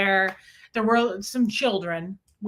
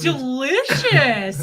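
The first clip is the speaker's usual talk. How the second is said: very drawn-out, higher, loud savouring; excited relish; 4 semitones higher, 5 dB louder, much slower than usual.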